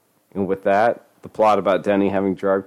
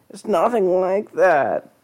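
high pleading voice; 12 semitones higher, slower than usual. Acted. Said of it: "Denny tried to explain".

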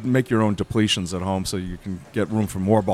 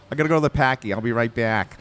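lightly put-on Boston accent; playful Boston accent demo; 2 semitones higher than usual. Shown as a demonstration. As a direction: do a quick Boston local. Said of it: "that was a good Boston accent".

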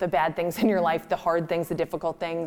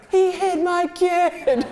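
high, loud, drawn-out protest; retold rage; 11 semitones higher, 6 dB louder, much slower than usual. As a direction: yell it slowly like an outraged parent. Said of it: "from a place of rage".

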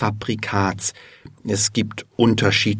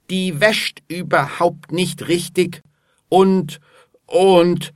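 higher-pitched annoyed stammer; stammering with annoyance; 8 semitones higher than usual. A narrator's reading. Alfred complains about his washing machine, stammering with annoyance. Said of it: "stottert Alfred vor Ärger".

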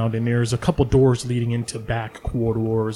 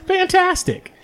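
squealed high Fantastic; bright hammed-up delight; slower than usual. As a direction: chirp it out, thrilled with the order. [name] delights at the order.

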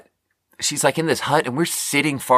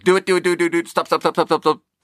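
high, rapid chant of do it then stop; egging each other on, pitched up; excited urging; 8 semitones higher, faster than usual. Retold or imitated. Two friends urging each other to pull over, high and excited.

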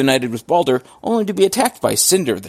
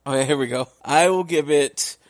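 ordinary talk

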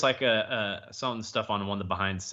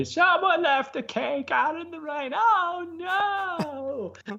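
very high, loud, silly character wail; high cartoon voice; 4 dB louder than usual. Showing off the hysterical voice, wailing about cake in the rain. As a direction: wail it way up high with long held notes. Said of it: "that voice is just hysterical".

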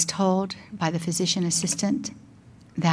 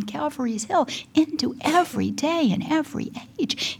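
high-pitched, emphatic preaching; fervent preaching; 8 semitones higher than usual. Real, not acted. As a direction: preach it with rising and falling fervour. Preaches with fervour on suffering.

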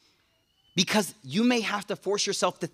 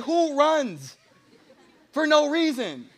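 wife's voice; 5 semitones higher, slower than usual. Retold or imitated. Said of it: "She's like".

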